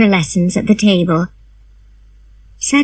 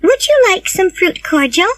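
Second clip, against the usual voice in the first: Anne's voice; 11 semitones higher than usual. A narrator's reading. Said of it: "asked Anne".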